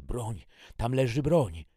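high, loud, urgent shouts; panicked cry. A narrator's reading. Attacked sister points Agnes to the gun, panicked. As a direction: cry out desperately, pitched high. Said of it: "zduszony i spanikowany głos mojej siostry".

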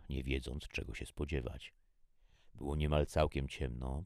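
usual speaking voice

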